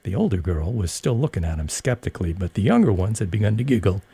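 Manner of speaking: plain reading voice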